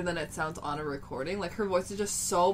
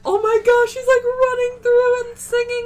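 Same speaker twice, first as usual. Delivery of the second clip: much higher, louder breathless excitement; thrilled fangirl; 11 dB louder than usual. Retold or imitated. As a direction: relive spotting the star in the aisle. Retelling seeing the star close.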